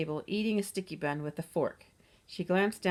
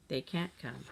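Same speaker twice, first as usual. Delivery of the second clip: lower, softer, heavy words; grimly; 5 semitones lower, 5 dB quieter than usual. A narrator's reading. Jill grimly repeating the news to her siblings. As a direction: let the bad news sink in. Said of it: "she repeated grimly".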